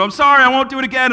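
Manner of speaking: put-on child rushing the same sorry to dad; kid apologizing again. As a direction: rattle it off like a caught child.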